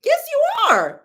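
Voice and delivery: high, loud retort; sharp retort. Retold or imitated. Reader firing back at the client, high and loud.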